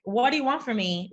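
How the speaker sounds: ordinary talk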